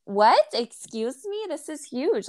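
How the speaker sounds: high, incredulous mock shock; retold astonishment. Shopper seeing the thick steak, retold astonishment.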